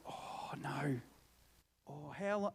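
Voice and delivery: soft, sinking "oh no"; acted hushed dismay; retold dismay. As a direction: let it out quietly, as if defeated. Retelling dismay at lacking bus fare.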